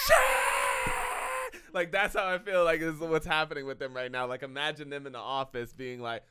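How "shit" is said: very high, loud shriek; movie scream. Retold or imitated. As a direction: scream it out at the top of your range. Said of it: "And she screams".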